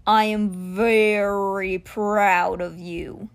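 very slow, solemn creature voice; proud stone cheetah. Acted. Stone cheetah lowers his head to praise young mage, slowly. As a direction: stretch out each word of praise.

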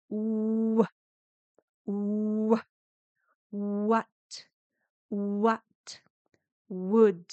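"U, u" held as a long rounded oo, then let go into "what" and "would"; long u released. Shown as a demonstration. Teacher shows the long u glide into would, slow and rounded. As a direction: stretch the oo in "U" and slide it into the w of "would". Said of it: "Hacemos una U larga y la soltamos".

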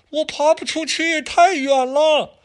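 put-on very high, drawn-out child's whine; whiny kid.

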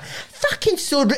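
high, laughing mock indignation; mock outrage. Mocking how locals judge a silent family, laughing mock outrage. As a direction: lampoon a huffy local, high and slow. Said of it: "everybody here would be like".

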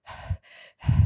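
a heavy, breathy sigh of frustration; loud exasperated sigh. Real, not acted. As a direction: let out a loud fed-up sigh. Stomach tightening at interruptions.

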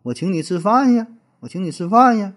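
Jinzhou dialect with the ends swinging up high; friendly Jinzhou accent, rising tune. Retold or imitated. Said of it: "锦州话也是乐调重音".

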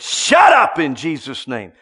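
loud, drawn-out command; stern rebuke. Retold or imitated. Pastor silencing a man screaming vulgarity, loud stern rebuke. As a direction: hit SHUT UP hard and loud.

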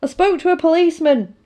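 woman urgently telling Simon Pegg about the policeman. Retold or imitated. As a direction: rush to tell him, a touch of alarm. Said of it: "She's like".

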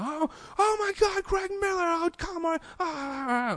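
very high, breathless fangirl squeal; hammed-up high squeal of an overexcited fan. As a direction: gush like a starstruck fan losing it. Fans gushing on meeting hosts.

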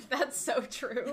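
drawn-out words breaking into laughter; laughing agreement. Real, not acted.

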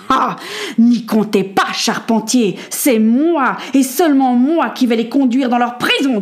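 emphatic, possessive refusal; scornful, forceful objection; menacing quartermaster. Acted. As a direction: overrule him, punching each "moi". Quartermaster forcefully overrules carpenter.

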